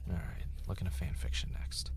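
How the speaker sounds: whispering a reminder to himself; whispered note. Acted. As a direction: breathe it out, unvoiced and close.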